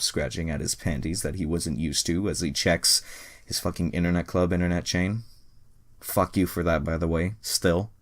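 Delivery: ordinary delivery, nothing marked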